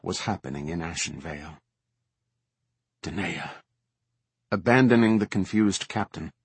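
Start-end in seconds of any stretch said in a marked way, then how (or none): 3.0-3.5 low, soft utterance of a name; quiet realisation. A narrator's reading. Commander thinking of her second, quiet and low. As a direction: say the name softly, almost to yourself.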